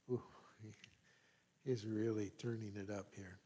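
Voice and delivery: normal talking voice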